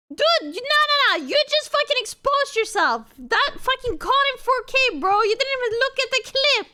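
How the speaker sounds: high, indignant scolding; worked-up calling out. Real, not acted. Catching someone out over the clip.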